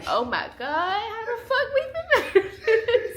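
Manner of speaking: high, loud laughing mock panic. Wife laughing at their broke military days, high mock panic. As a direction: squeal it out in laughing disbelief.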